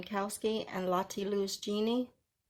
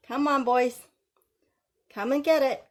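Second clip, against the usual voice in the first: louder and higher, calling pets over; calling the dogs; 6 semitones higher, 6 dB louder than usual. Pretend. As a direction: call them in like dogs at feeding time.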